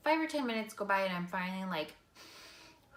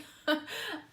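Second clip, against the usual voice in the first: light laugh; 13 semitones higher than usual. Real, not acted. Sheepish laugh after teasing coworkers.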